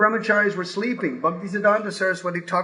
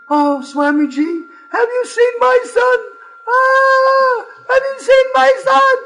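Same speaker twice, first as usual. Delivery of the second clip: high, loud, wailing plea, close to tears; tearful mother; 7 dB louder, slower than usual. Retold or imitated. Mother begging Swamiji for her son, high tearful wail. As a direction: cry out the question like a frantic mother. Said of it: "the mothers would practically be in tears".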